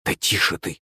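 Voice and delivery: soft, urgent shushing; hushed hiss. A narrator's reading. Boy silencing Yulenka near adults, hushed hiss. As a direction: shush her under your breath. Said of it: "зашипел я".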